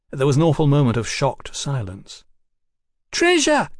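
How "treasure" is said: put-on very high excited boyish shriek; shrill it out to the gang; shrill cry. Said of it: "shrilled one of the boys".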